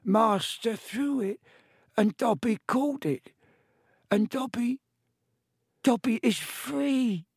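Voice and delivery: high, slow house-elf wonder; awed elf voice. Acted. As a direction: savour each word of freedom. Elf realises his freedom.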